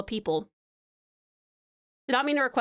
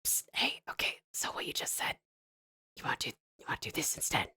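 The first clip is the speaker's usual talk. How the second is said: sneaky unvoiced whisper, faster at the end; 10 dB quieter, faster than usual. Player secretly whispering to the game master mid-session, whispered. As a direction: lean in and whisper a secret suggestion. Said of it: "I'm gonna be like".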